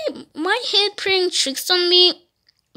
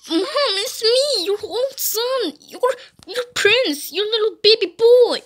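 pleading son; 3 semitones higher than usual. Pretend. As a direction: plead like a little boy to his mother. Son pleads with zombie mother.

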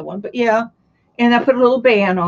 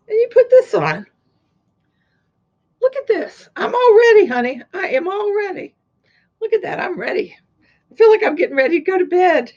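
playful high voice; 9 semitones higher than usual. Pretend. Modeling hat playfully.

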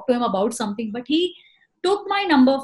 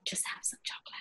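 conspiratorial whisper; 18 dB quieter, faster than usual. Acted. Performer ending the bit, whispering the punchline. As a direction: let the last words slip out as a secret. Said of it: "that was superb".

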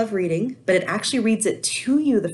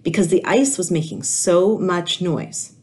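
put-on lively read-aloud voice for kids; animated children's story reading; storybook narration; 5 dB louder than usual. A narrator's reading. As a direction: tell it like a picture book to a class. Teacher reads picture book aloud.

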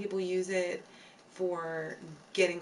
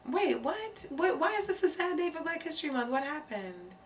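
surprised; 7 semitones higher than usual. Real, not acted. Shocked at a chat message.

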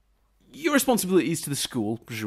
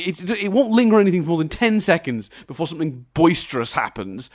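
ordinary talk

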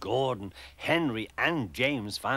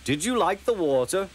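high-pitched amused question; chuckling tease; 8 semitones higher, 4 dB louder than usual. A narrator's reading. Henry teases Percy about the water, chuckling. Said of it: "chuckled Henry".

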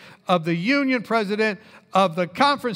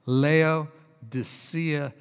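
slow, syllable-by-syllable delivery; drawn out in separated pieces; mock-solemn diagnosis; 5 semitones lower, much slower than usual. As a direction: sound out the long medical name carefully. Coining a mock disease name slowly.

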